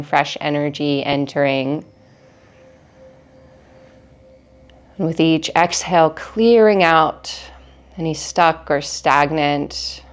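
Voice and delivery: calm, measured meditation guide with long pauses; guided meditation. Acted. Teacher leads listeners through breathing in an energy cleanse, calm guided meditation. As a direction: guide them gently through each breath, unhurried.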